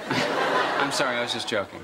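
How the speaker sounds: sorry breaking into laughter; laughing apology. Real, not acted.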